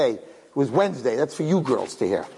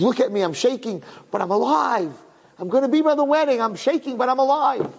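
high-pitched retold joy, mildly tearful; happy crying; 7 semitones higher than usual. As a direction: imitate a shaking man overjoyed to be alive. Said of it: "He was crying out of happiness".

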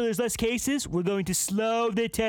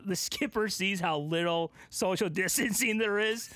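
ordinary talk, nothing marked

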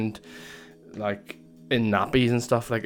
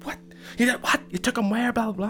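much higher, mock outraged yell; outraged dad, retold; 12 semitones higher than usual. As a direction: play the dad losing it, hammed up. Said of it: "went psycho".

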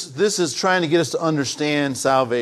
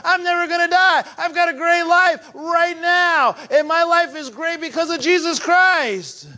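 high, driving emphasis on every claim; very emphatic; 9 semitones higher than usual. Real, not acted. Preacher to the church, declaring eternal life starts now, emphatic. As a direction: preach it with full conviction, high and insistent. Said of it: "let me say it very emphatically".

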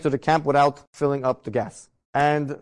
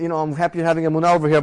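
ordinary delivery

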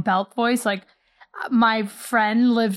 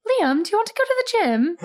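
higher mock British accent; fake British accent; 11 semitones higher than usual. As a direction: ask it like a put-on Brit. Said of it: "I'd be like".

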